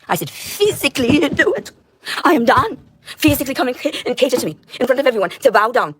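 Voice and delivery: high, loud furious screaming; screaming demands at everyone, voice cracking high; shrill furious rant. Real, not acted. Ranting at the world, furious high scream.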